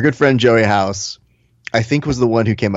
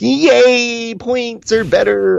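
mock gleeful fans; 10 semitones higher than usual. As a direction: parody gloating fans in a silly high voice. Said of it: "who are Points fans, being like".

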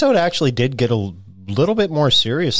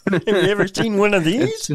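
imitating Kermit clip; 7 semitones higher than usual.

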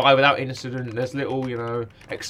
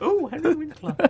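voiced high falsetto coo, much higher; starstruck squeal; 13 semitones higher than usual. Host repeating Henry Winkler's name in a high starstruck coo. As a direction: coo the name like a smitten fan. Fanboy swoon at actor's name.

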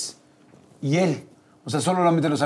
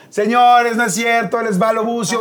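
announcing to the crowd; 6 semitones higher than usual. Retold or imitated. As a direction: present the reveal like a stage host. Doble anuncia al público la broma. (anuncio teatral al público)

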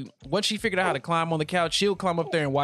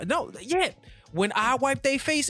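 lightly exasperated, pitched up; excited high; 9 semitones higher than usual. Real, not acted. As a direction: voice jumps up high in disbelief. Excited complaint about wiping faces.

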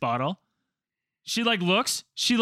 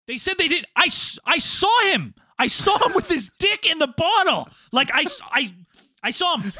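incredulous protest; 9 semitones higher, 4 dB louder than usual. Retold or imitated. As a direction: plead your case in disbelief. Retold arguing with the principal.

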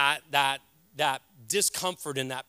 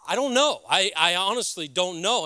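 blunt uncertainty; 3 semitones higher, 7 dB louder than usual. Retold or imitated. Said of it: "I'm like".

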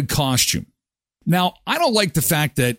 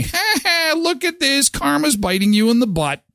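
high-pitched sing-song mock gloat; nyah-nyah teasing, pitched way up; gloating taunt; 13 semitones higher than usual. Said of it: "the time for me to say".

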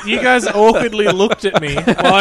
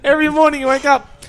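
ordinary talk

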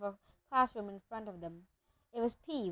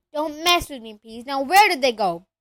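raised voice, loud punch on "where"; exasperated demand; 3 semitones higher, 10 dB louder than usual. A narrator's reading. Filch loses patience with Peeves, loud and exasperated. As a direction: angrily demand an answer.

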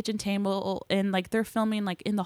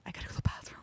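urgent hushed whisper; 8 dB quieter, much faster than usual. Retold or imitated. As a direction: breathe it, no voice. Embarrassed on quiet film set.

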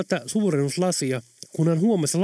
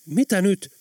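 higher-pitched, quick alarmed question; mildly startled narrated voice; 6 semitones higher, 3 dB louder, slower than usual. A narrator's reading. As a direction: ask with a touch of fright. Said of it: "kysyi Helena säikähtäen".

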